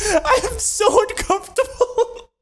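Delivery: very high, drawn-out squeal through laughter; helpless laughing. Real, not acted. Storyteller reliving the awkward night, laughing helplessly. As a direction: wail it while cracking up.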